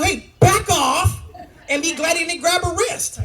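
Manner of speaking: high, slow acted warning with a laugh; mock confrontation. Dad warning the bully's mom, hammed-up slow high warning. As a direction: play it as a cocky warning to the other mom.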